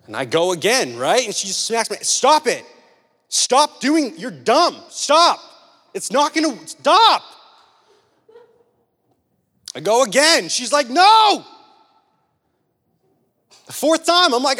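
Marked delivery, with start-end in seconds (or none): 2.0-7.2 put-on loud, high scolding of a child grabbing at an iron; escalating grandma shout; grandma yelling stop. 10.9-11.5 loud, high sharp no; sudden grandma yell; grandma's shout. Retold or imitated. Grandma stopping child again.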